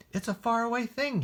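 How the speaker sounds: put-on high and quick, eager child; eager boy voice. Boy assuring his mother about the cutlass, high eager voice. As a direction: insist brightly like a small boy.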